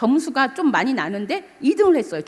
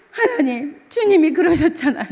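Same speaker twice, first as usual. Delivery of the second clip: crying prayer; 7 semitones higher, 6 dB louder than usual. Retold or imitated. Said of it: "그래서 막 울면서 그러면서 잤어요".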